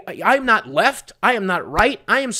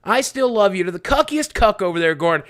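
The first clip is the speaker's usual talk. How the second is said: ordinary delivery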